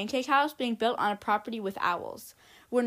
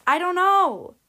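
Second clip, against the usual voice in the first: higher and louder, falling on know in helpless despair; helpless outburst; 7 semitones higher, 9 dB louder, slower than usual. Acted. Doctor to the frightened parents, a loud helpless cry. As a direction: throw up your hands, "know" falling away.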